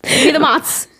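mozz said short, "motz"; New York mozz. Shown as a demonstration. Ordering food, New York Italian "mozz". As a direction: clip mozz, Italian-American style. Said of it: "how I say mozzarella".

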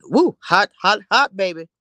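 high, loud Woo then hot, hot, hot; excited whoop. Real, not acted. Reacting to the hot scene between August and Kelvin, whooping excitedly. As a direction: hype it up like a fan. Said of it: "hot scene exclamation".

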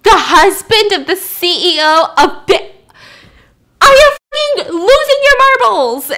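a furious boss losing it on the phone; boss yelling. Retold or imitated. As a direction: boss yelling at the captain over the phone, building to a shriek. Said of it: "it's his boss yelling at him".

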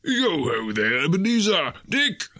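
put-on rich, fat, jovial old man calling out, rising high; a jolly boss hailing his apprentices. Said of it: "comfortable, oily, rich, fat, jovial voice".

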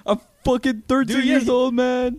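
high, loud protest; outraged kid. Retold or imitated. Eighth grader protesting to his coach, high and loud. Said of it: "And he was like".

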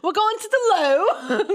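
high-pitched mock British; giggly put-on British accent. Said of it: "I'm really good at British".

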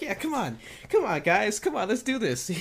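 high, hyped-up mock cheerleading; rally the gym with hammed-up giddiness; giddy. Trainer hyped on pre-workout rallying the gym, giddy. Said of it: "like, all giddy".

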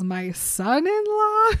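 high, slow, appalled disbelief; incredulous. Retold or imitated. Bella to Jacob, appalled and rising high. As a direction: stretch it out in shocked outrage. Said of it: "And Bella's like".

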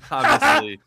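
high laughing voice; can't stop laughing while talking. Real, not acted. Laughing at Pee Wee impression.

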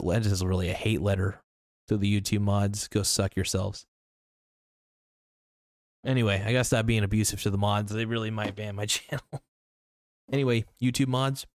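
ordinary talk

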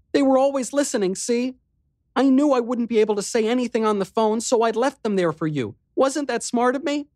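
put-on high, fast, pleased girl's voice; a young girl boasting a bit; proud little sister. A narrator's reading. Riley voiced, proud of herself.

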